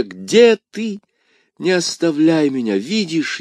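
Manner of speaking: high, pleading cry losing volume. A narrator's reading. Dying woman begs D'Artagnan to stay, desperate cry. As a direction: plead not to be left. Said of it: "крикнула госпожа Бонасье".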